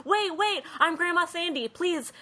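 put-on high, excited old lady calling; grandma calling for the others to wait, high and eager; Grandma Sandy. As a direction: hurry after them, calling out eagerly.

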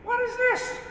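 sudden high cry of astonishment; shocked disbelief. Retold or imitated. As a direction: blurt it out, caught off guard by your own words. Shocked at his own prayer.

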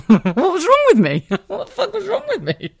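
high, incredulous cry through laughter; laughing. Real, not acted. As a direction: crack up in disbelief at yourself. Laughing at her past self.

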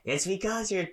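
high scolding voice; mock scolding mom. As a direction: lecture like a tired mom. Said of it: "And she's like".

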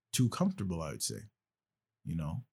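ordinary delivery

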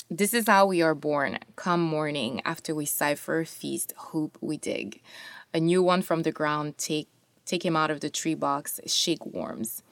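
soft, hushed poem reading; quiet poetry reading. Acted. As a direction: read it near a whisper. Reads second poem aloud.

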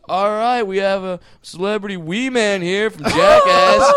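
loud, high tour guide announcement; tour guide announcing. Retold or imitated. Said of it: "the guy goes".

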